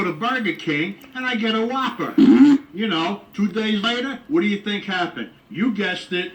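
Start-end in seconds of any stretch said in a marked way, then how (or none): none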